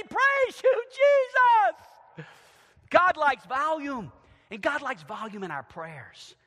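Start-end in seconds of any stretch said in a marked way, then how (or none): 0.0-2.3 high, loud shout of "Praise you, Jesus". Shown as a demonstration. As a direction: shout it to heaven. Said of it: "It's gonna be".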